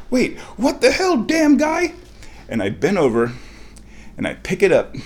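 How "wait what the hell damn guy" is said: high-pitched baffled outburst; startled disbelief. Retold or imitated. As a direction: re-enact being stunned, pitch shooting way up. Re-enacts disbelief at the find.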